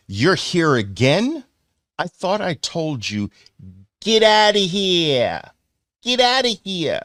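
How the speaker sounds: New York accent, hammed up. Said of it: "my New York accent".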